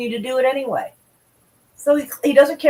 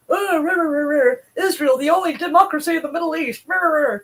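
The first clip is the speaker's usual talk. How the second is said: lightly mocking, high "rah, rah" chant; mock cheerleading; 6 semitones higher than usual. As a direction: parody the flag-wavers, pitched up. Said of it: "people going".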